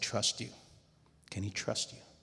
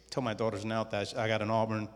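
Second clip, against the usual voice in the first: normal talking voice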